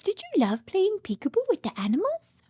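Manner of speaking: ordinary delivery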